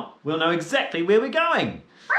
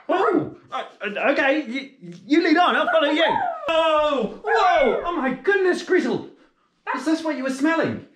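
excited; 6 semitones higher than usual. Acted. The dog smells something.

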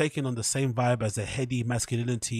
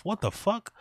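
high disbelief; 8 semitones higher than usual. Real, not acted. Disbelief at the writing.

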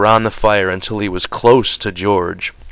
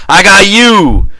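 high-pitched angry tirade, bursts of shouting; heated, raised-voice scolding; furious; 7 semitones higher, 4 dB louder than usual. A narrator's reading. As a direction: lay into your companion with accusations. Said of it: "George went on furiously".

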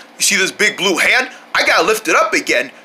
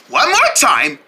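usual speaking voice

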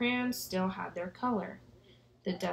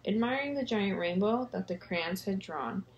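plain reading voice

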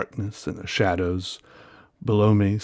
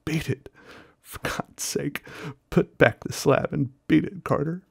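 higher, desperate pleading cry; piteous cry; 7 semitones higher than usual. Acted. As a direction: plead pitifully. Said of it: "a piteous cry from Warren".

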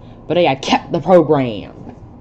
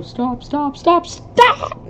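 rising to a high loud cry; pleading stop; 12 semitones higher, 3 dB louder, much slower than usual. Acted. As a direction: beg them to stop, building. Pleading in the scene.

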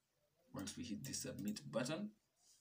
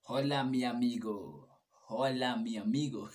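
put-on louder, playful Spanish accent; hammed-up Spanish accent; 2 semitones higher, 11 dB louder than usual. Reading the translated output to viewers, louder mock Spanish accent. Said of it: "I don't know why I'm doing an accent".

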